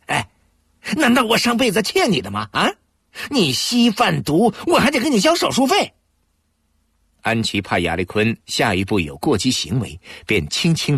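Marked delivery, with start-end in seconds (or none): 0.1-5.9 high, indignant outburst; furious at paying her bills; angry. A narrator's reading. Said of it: "他气愤地问".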